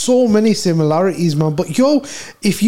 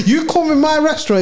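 laughing through the words, pitch high; cracking up at his own joke; laughing while talking about his imagined restaurant; 8 semitones higher, faster than usual. Real, not acted.

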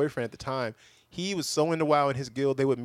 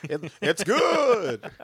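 high, loud, very drawn-out word; hammed-up slow, high-pitched mimicry; exaggerated mocking echo; 8 semitones higher, 5 dB louder, much slower than usual. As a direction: stretch it out high and silly. Mocking repeat of friend's words.

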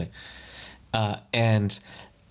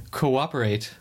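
ordinary talk

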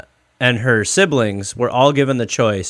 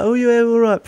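put-on higher, broad West Midlands accent; 10 semitones higher than usual. Imitating the siblings who chose swimming, West Midlands accent. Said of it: "they all still talk like".

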